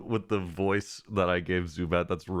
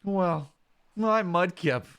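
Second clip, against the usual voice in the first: gentle, lightly voiced young buddy; partner Mudkip voice; 12 semitones higher than usual. Mudkip partner telling about the Krabby bubbles, lightly voiced. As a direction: describe the sunset bubbles dreamily.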